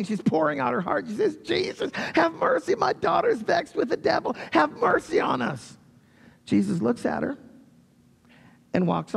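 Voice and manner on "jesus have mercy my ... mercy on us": much higher, pleading cry for help; desperate pleading mother. Acted. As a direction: beg like a desperate mother for her child. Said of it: "She's probably sobbing".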